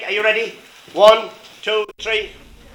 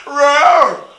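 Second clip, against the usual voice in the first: a big, high roar with a falling end; lion roar; 8 semitones higher, 9 dB louder than usual. Acted. The lion roaring at Maggie's door, hammed-up roar. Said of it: "And the lion went".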